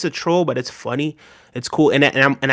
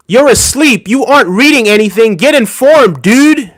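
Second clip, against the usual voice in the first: higher, louder, mocking the angry chat comment; mock angry read; 6 semitones higher, 5 dB louder than usual. Reading a viewer's chat comment aloud, in mock anger. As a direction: shout it back like an outraged commenter. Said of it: "Man, you sound angry".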